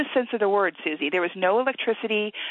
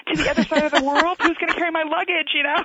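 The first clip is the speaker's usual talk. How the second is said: higher, louder, indignant disbelief; mock outrage; 7 semitones higher, 3 dB louder than usual. Wife retells her reaction to husband, mock outraged. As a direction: play up the spoiled wife, half joking. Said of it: "And I'm thinking".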